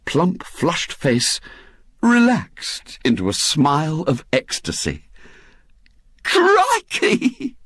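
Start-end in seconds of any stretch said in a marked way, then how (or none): 6.2-7.5 very high, awestruck exclamation; breathed amazement. A narrator's reading. Missus Bott reacts to news of the newspaper, breathed amazement. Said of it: "she breathed".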